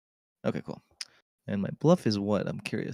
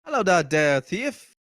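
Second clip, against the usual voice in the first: character voice; 9 semitones higher, 4 dB louder than usual. Acted. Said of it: "I forgot how to do his voice".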